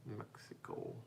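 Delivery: a breathless whisper to himself; just mouth it, barely voiced; whispered echo. Real, not acted. Murmurs the word back to himself.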